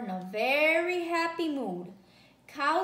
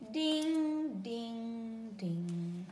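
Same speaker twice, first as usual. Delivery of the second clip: slow bell chime fading softer; bell ding fading; 2 semitones lower, 5 dB quieter, much slower than usual. A narrator's reading. Cow's bell as she walks away, fading softer. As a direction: ring it away into the distance.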